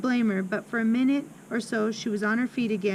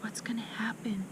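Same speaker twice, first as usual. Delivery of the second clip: soft, anxious-question whisper; 11 dB quieter than usual. A narrator's reading. Lavender asks Horatius what is coming, whispered. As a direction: whisper back to a friend. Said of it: "Lavender whispered back".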